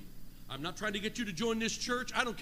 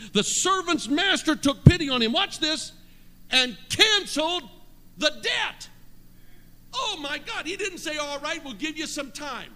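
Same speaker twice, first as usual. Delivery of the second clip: high, shouted preaching at full force; exultant preaching; 9 semitones higher, 5 dB louder than usual. Real, not acted. Preacher rejoicing that the debt is canceled, exultant shouting. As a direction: cry it out in triumph, drawing out key words.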